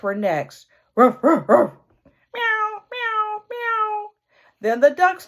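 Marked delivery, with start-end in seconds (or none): 1.0-1.7 loud barking ruff; dog bark. Acted. Dogs bark in farmyard. 2.3-4.1 high mewing cat; cat meow. Acted. Cat in farmyard.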